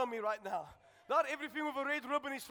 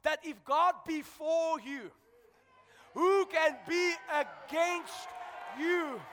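full-force preaching; 4 semitones higher, 4 dB louder than usual. Acted. Preacher stirring the congregation, loud high shouting preaching. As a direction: preach it over the music to the church.

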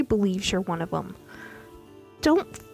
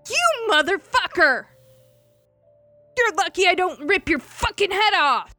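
much higher and louder furious roar; staged threat shouted at Ilya for show; shouting; 10 semitones higher, 7 dB louder than usual. Acted. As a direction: bellow it for the whole ship to hear. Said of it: "Archmay shouted".

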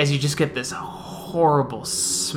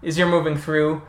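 ordinary delivery

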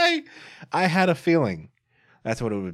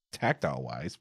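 ordinary talk

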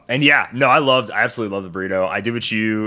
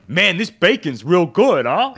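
higher, louder mock mobster voice; Sopranos-type guy; 9 semitones higher, 5 dB louder than usual. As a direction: play the Sopranos neighbour praising the bacon. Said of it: "he was just like".

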